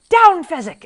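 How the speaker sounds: loud, high shouted command; urgent yell. A narrator's reading. Inigo orders frozen Fezzik down, urgent yell. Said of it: "And he yelled".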